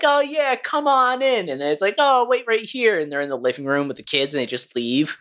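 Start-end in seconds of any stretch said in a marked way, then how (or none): 0.0-1.5 high, sing-song fake welcome; sweetly welcoming the Jehovah's Witnesses in; mock welcoming host. Parents welcoming visitors, high and sweet. 2.0-3.0 high, slow sugary voice; sweetly asking them to wait; mock parent. Parent leaving the kids with visitors, high and slow.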